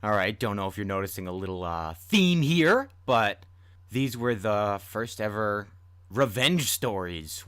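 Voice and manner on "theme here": ordinary delivery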